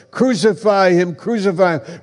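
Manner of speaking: high, loud crowd chant "Crucify him"; mob cry. Acted. Voicing the crowd at the cross.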